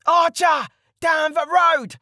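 very high, strained yell; screamed warning. A narrator's reading. Bandit leader alerting his men to an archer, screaming. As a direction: yell the alarm to your gang. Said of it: "the leader screamed".

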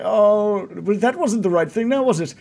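a lightly voiced sheepish Ismark; wry self-rebuke. Ismark after failing to calm the dog, lightly voiced rueful. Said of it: "He's just like".

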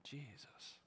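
a single breathed whisper of the name. Shown as a demonstration. Preacher whispering the name to the congregation, hushed. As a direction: breathe the name out, barely voiced. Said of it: "closing whispered prayer to listeners".

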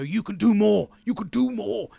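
retold fired-up urging of a friend; emphatic urging. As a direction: raise your voice and press him. Urging lazy friend to do more.

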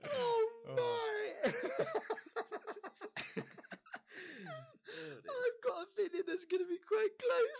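high, breathless laughing speech; crying with laughter. Real, not acted. Host weeping with laughter at the attempt. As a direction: wheeze through tears of laughter.

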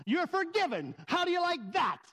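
high, mock-furious taunting; hammed-up furious outburst; angry forgiveness. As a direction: rant it like a sarcastic dare, pitch climbing. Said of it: "And I was so angry".

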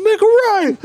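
very high laughing shout; hammed-up scared teen screeching; shrill panicked yell. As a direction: scream the turn at the driver. Scared teens yelling directions.

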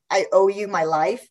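normal talking voice

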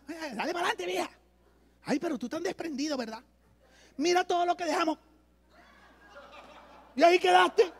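husband urging forward, wife looking back, lightly voiced; mock couple bickering. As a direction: play the husband and wife squabbling.

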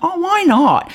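high New Zealand "Oh, why not?"; New Zealand accent. Retold or imitated. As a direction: say it again in a Kiwi accent. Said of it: "in her most New Zealand accent".

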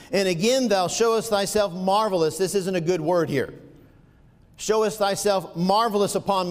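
impassioned King James reading; fervent preaching. Acted. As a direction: preach it out to the room. Preaching at full force.

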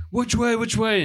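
high, pressing repeated question; mock panic at the wheel; urgent asking. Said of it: "I'm like".

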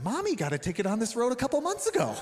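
put-on the four-year-old blurting it out; little son. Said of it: "He's like, he's like".

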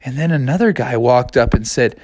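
plain reading voice

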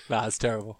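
usual speaking voice

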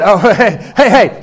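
loud laughing "hey, hey"; mock warning. Wife stepping in on the flirting cashier, loud mock warning. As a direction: playfully cut in to stop her.

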